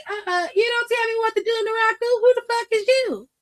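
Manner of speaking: high, cocky voice pushed way up; Mason Rook. Retold or imitated. Mason Rook snapping back at Naraku in a high cocky voice. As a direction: squeak out the defiance at Naraku. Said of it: "he was like".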